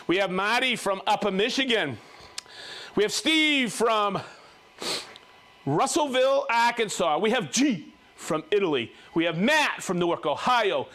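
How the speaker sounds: ordinary talk, nothing marked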